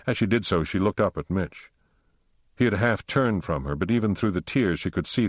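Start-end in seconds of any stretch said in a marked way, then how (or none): none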